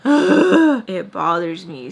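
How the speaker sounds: sharp gasp, then slow words. Real, not acted. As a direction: catch your breath, then say it slowly.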